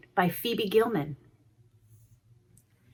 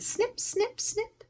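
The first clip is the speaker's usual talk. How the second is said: snip snip pitched up high; squeaky snips; 5 dB quieter, slower than usual. A narrator's reading. Reader to children, high squeaky snip snip. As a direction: snap it out like scissors. Scissors sound effect.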